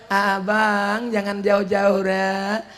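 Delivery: wife begging her husband not to leave, softly; soft loving words. Retold or imitated. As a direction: coax him gently, like a clinging wife. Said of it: "Sekarang kata-katanya lembut".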